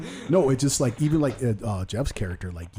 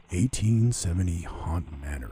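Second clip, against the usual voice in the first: slow, drawn-out announcer voice; haunt announcer impression; 3 semitones lower, slower than usual. Podcaster imitating a haunt video host, slow announcer voice. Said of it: "the dude from the Haunt Line".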